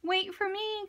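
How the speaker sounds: high mouse calling after friends; little mouse crying. A narrator's reading. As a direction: squeak it out like a small mouse left behind. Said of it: "cried Mouse".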